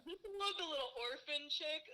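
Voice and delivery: a hint of clueless boyfriend; boyfriend imitation. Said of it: "Exactly how he said it".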